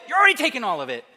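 hammed-up indignant comeback; mock exasperated retort. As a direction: throw it back in mock outrage. County retorts in acted quarrel.

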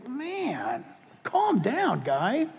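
softly voiced thought, aimed at the loudmouth; quiet inner remark. Pastor recalling his thought about the yelling fan, quiet aside. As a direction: say it under the voice, as a private reaction. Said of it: "and I'm thinking".

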